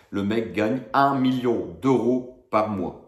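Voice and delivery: usual speaking voice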